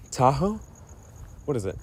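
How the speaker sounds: ordinary delivery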